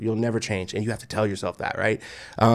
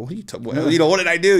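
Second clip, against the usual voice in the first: higher, louder, flustered self-defence; startled protest; 7 semitones higher, 5 dB louder, faster than usual. Retold or imitated. Husband confronted by his wife, startled protest. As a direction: stumble into a bewildered, raised protest.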